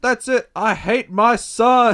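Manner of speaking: high, loud declaration; explode, stretching the last words; acted angry outburst. Dad giving up on compassion, angry outburst.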